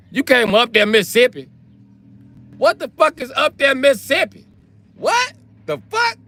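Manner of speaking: very high, incredulous squeal; squeaky, exaggerated bafflement; mock disbelief.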